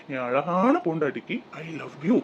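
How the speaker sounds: husband's soft, slow I love you to make up; tender. Acted. Husband making up with wife.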